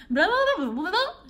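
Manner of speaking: high, loud rapid babble of a foreign officer; fast gibberish. Retold or imitated. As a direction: rattle off meaningless syllables at speed. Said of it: "eles falam muito rápido".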